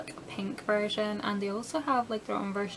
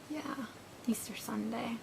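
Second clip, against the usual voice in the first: whispering; 9 dB quieter than usual. Real, not acted. Aside repeating date softly.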